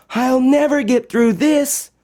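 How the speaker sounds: put-on high, small voice of a worried girl; Josephine despairing. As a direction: sound defeated at the foot of a mountain. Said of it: "said Josephine".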